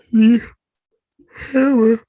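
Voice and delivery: loud, high yawn over the words; words stretched wide by a real yawn. Real, not acted. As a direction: yawn right through the words. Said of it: "I keep yawning".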